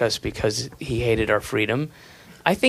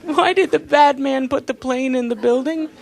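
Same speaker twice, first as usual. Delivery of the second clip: put-on childlike question; 11 semitones higher than usual. Childlike voice of the nation.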